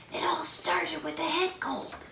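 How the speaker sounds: high hushed sore throat voice. Acted. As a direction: keep the sore throat hush. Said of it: "I have a very sore throat".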